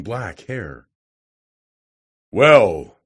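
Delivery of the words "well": a loud, roaring "Well". A narrator's reading. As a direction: bellow it at the visitor. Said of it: "he said in a roaring voice".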